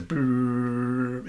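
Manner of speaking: long, steady purring brrr; drawn-out electrical purr on one note; imitated dial tone. Shown as a demonstration. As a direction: hum a sustained rolled dial-tone purr. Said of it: "more like a purring sound".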